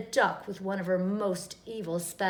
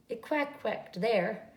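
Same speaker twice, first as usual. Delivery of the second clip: usual speaking voice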